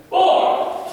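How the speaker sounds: loud, high count shouted out; called drill count. Real, not acted. Coach counts punches for the class, loud high call. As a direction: bark the number to the class.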